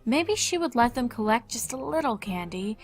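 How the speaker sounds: ordinary talk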